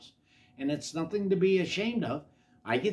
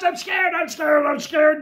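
high, loud panicked cries; terrified scream; 13 semitones higher, 10 dB louder than usual. A narrator's reading. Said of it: "Jim screamed".

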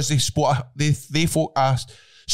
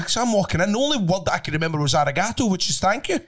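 ordinary delivery

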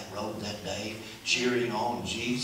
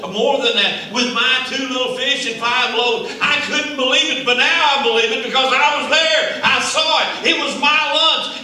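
building to a loud, high shout; preaching at full force; 12 semitones higher, 12 dB louder than usual. Acted. Preacher voicing the little boy's testimony to the congregation, loud and high. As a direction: climb into a triumphant preaching cry.

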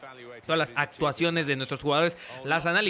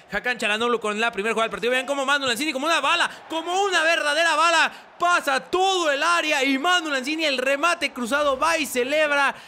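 fast, rising, louder goal call; live play-by-play; 9 semitones higher, 4 dB louder than usual. Acted. Commentator calling a goal replay for viewers, excited play-by-play. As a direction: build from brisk to high, loud excitement.

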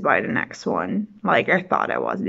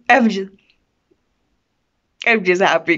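normal talking voice

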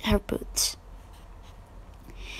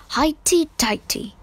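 haughty aunt; 5 semitones higher than usual. A narrator's reading. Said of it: "said Aunt March".

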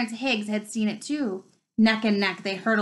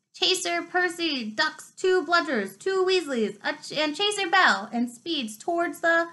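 lively commentator call, pitched higher; match commentator; 8 semitones higher than usual. Acted. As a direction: announce it like a schoolboy commentator. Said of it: "Lee Jordan was saying".

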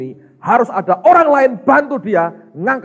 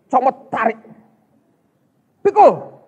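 soldier's orders; 6 semitones higher, 4 dB louder than usual. Retold or imitated. Soldiers barking orders at Simon.

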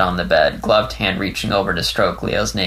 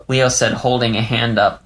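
ordinary delivery, nothing marked

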